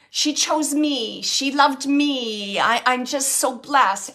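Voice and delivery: relayed joy, slow and drawn out; happy, blessed. Retold or imitated. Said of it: "how happy he is".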